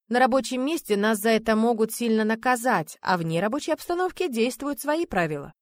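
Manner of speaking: ordinary delivery, nothing marked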